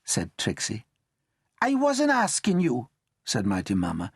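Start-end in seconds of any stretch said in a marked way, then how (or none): 1.6-2.9 high, slow, cold snub; slow frosty nonna voice; curt grandmother. Acted. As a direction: put her firmly in place. Grandmother snubs Trixie.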